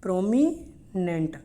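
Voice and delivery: slow PROMI, pause, NENT in prominent; syllable-by-syllable pronunciation. Shown as a demonstration. Teacher sounding out a word for learners, in two parts. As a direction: break prominent before its last syllable.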